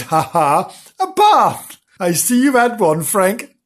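high, loud, taunting mew of a voice; mocking cat. Bernard the cat taunting Frank after his bath, high gloating. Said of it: "he mewed".

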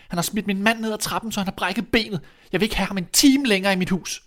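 high-pitched indignant wife; upset outcry. Acted. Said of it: "råbte hun". (oprørt, højt råb)

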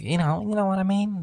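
high-pitched mock hype voice. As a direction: pitch it way up, poking fun. Mocking the rap line he quoted.

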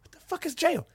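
high-pitched baffled outburst; retold bafflement. Retelling his confusion at the cousin's word, exaggerated bafflement. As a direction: squeak it out, totally lost. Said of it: "I'm like".

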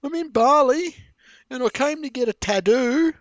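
high, loud imitation of a bawling Aussie girl; hammed-up tearful wail, stretching the words; mock bawling. Retelling a crying Aussie girl's Bali tattoo story, mock bawling. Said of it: "she's bawling. She's fucking bawling, and she's like".

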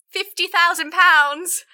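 slow, high and loud; dramatic ransom demand. Retold or imitated. Wife naming the ransom to her husband, slow, high and loud. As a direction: drag out the sum with theatrical weight. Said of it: "And she's like".

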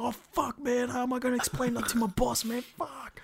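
high, worried mock voice; panicking character.

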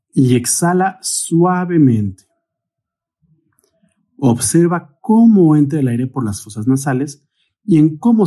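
unhurried meditation guide, long pauses between cues; guided meditation. Real, not acted. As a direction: guide the breathing calmly, stretching out each cue word. (meditación guiada, con calma y largas pausas)

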